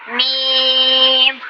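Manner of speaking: میم held long with the mouth open, not through the nose; open-mouth meem. Shown as a demonstration. Teacher showing students a clean میم, drawn out. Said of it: "منہ کھول کے".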